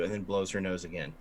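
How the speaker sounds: ordinary talk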